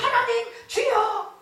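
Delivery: high, slow, fervent cry to God; mock fervent plea, pitched high and drawn out; fervent prayer cry. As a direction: call out to God with longing.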